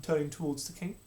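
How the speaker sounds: ordinary delivery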